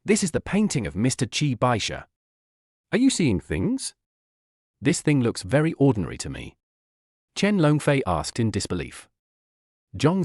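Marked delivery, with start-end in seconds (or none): 0.0-6.5 serious. A narrator's reading. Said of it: "said to them seriously".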